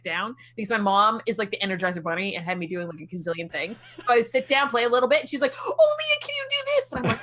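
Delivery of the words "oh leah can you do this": mom's high-pitched Newfie voice; squeaky-high mock Newfie request; Newfie accent. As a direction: imitate mom in a high, comic Newfoundland accent. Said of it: "That was a great Newfie accent".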